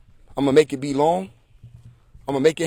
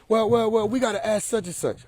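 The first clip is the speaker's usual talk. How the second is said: mock hedging; 7 semitones higher than usual. Imitates followers hedging on the order.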